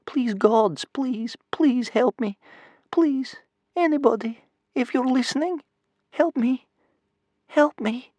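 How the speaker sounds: put-on very high little boy's begging; tearful child's inward prayer, high and small; repeated desperate plea. A narrator's reading. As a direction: plead over and over, in short separate phrases. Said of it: "inside his head he repeated over and over".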